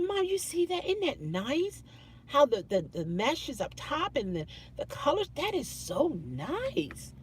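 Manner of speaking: chatty shopping tone. Retold or imitated. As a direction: admire the drapes like it's a day out. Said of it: "as if we were going shopping for drapes".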